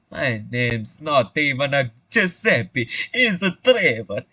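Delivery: put-on slow broad Italian accent. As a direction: spell it out slowly in stage Italian. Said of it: "talk like a broad Italian stereotype".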